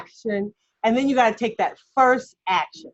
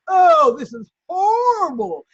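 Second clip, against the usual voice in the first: a hammed-up wail, higher and louder, slowed; mock wail; 8 semitones higher, 5 dB louder, slower than usual. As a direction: wail it in mock despair at the singing. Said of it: "I'm like".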